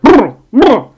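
loud high "brr, brr" shiver sound; shudder noise. Retold or imitated. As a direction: shake it off like strong coffee. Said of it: "You're like".

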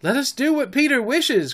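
high-pitched obedient cry; high boyish exclamation; eager cry. A narrator's reading. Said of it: "cried the simple boys".

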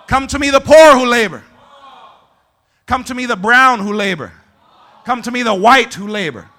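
ringing call with long pauses between phrases; proclaimed scripture. Real, not acted. Preacher calling every group to come, proclaimed scripture. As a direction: call out each group like an open invitation.

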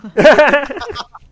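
high, loud burst of real laughter. Real, not acted. Friend reacting to a joke about testosterone smell, laughing loudly.

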